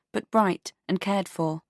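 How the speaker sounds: ordinary delivery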